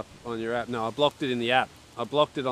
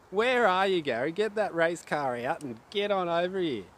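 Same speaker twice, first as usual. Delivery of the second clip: ordinary talk, nothing marked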